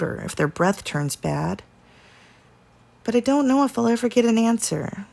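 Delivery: ordinary delivery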